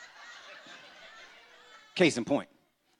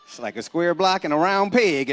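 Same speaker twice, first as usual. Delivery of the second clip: ordinary talk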